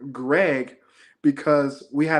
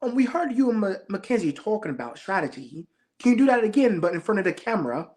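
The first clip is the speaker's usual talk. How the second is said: high-pitched mock London accent; 5 semitones higher than usual. London producer asking contestant to repeat strategy talk on camera, imitated London accent. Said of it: "they're from London".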